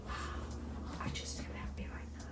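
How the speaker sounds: hushed, breath-only wonder; blissful whisper. Acted. Sitting alone as it rains outside, blissful whisper. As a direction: whisper it as a private little revelation. Voicing a quiet moment of happiness.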